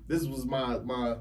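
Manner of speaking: usual speaking voice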